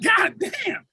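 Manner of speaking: high laughing cry of disbelief; mock disbelief between laughs; laughing exclaim. Retold reaction, laughing.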